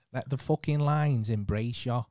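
slow Manc character line; second go at Manc, drawn out; put-on Manc accent. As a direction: lean slowly into a Manc accent. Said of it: "I just needed two takes".

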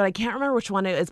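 ordinary talk

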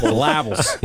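laughing "Syllables" as suh-LAB-ulls; amused, mangled suh-LAB-ulls for "Syllables"; playful mispronunciation. Pretend. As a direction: crack up while stressing LAB in "Syllables". Repeats the joke word, laughing.

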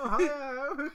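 Ohio squeaked high and loud in mock British, laughing; mock British Ohio, high and giggly; hammed-up British Ohio; mock British accent. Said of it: "upset at someone saying Ohio in an accent".

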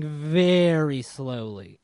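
slow, dragged-out emphasis; drawn-out slowness. Real, not acted. Telling friends about the crawling signing line, drawn-out. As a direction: stretch the words out slowly. Stresses how slowly line moved.

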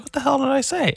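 very high-pitched mock protest; indignant younger self. Kid answering skate park scolders, high indignant protest. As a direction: squeal it as a baffled complaint. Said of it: "And I was like".

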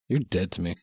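flat, dismissive deadpan; icy fake rejection; mock coldness. Mock disowning over stuffing.

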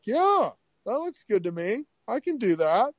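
high-pitched reenacted enthusiasm; keen, impressed. Storyteller voicing his younger self after the movie, high and keen. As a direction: pitch it way up, playfully won over.

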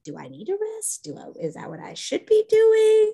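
high, questioning self-talk, pitch way up throughout; mock confused rambling. Friend voicing a grieving mother's inner confusion, pitched high and searching. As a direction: play the overwhelmed griever second-guessing every feeling. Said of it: "And you're just like".

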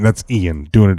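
usual speaking voice

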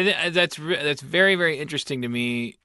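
normal talking voice

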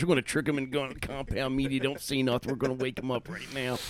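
hushed conspiratorial prank host; hushed prank intro. Pretend. Jackass-style prank intro.

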